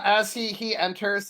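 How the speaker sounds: normal talking voice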